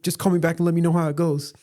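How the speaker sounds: high, quick female impersonation; imitated wife. Wife letting him take mom's call, high imitated voice. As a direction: lift the voice high and hurry it along. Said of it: "And she's like".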